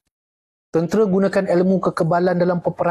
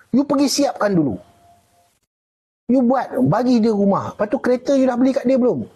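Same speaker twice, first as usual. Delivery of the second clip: higher-pitched mock voice of the one scolding; a teasing, higher take on the scolder; imitating the scolder; 8 semitones higher than usual. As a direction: put on a higher voice to play the one telling you off.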